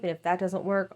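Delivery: usual speaking voice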